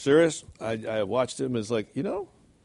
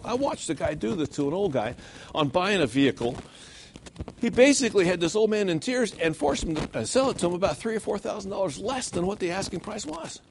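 usual speaking voice

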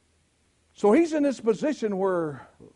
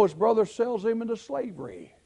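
ordinary talk, nothing marked

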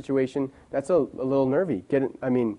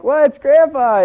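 excited shout; 12 semitones higher, 9 dB louder, much slower than usual. Retold or imitated. Kids shouting excitedly at grandpa.

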